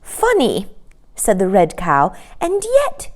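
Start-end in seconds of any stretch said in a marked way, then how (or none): 0.0-0.7 cow's voice. A narrator's reading. Cow answers. 2.4-3.1 high, hesitant cow voice; pausing. A narrator's reading. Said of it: "she paused".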